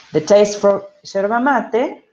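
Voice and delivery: careful, slow classroom reading of English. Shown as a demonstration. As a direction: read the example slowly for students. Reads English phrase aloud.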